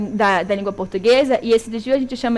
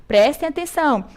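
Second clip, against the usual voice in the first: normal talking voice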